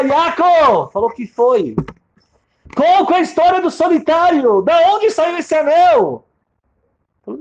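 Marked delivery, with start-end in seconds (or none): 0.0-0.9 gritar o nome, alto e agudo, em lágrimas; crying out his name. Acted. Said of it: "Ela volta pra casa chorando". 1.1-6.3 lamento alto e muito agudo, bem lento no final; distraught wailing. Acted. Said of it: "Ela volta pra casa chorando".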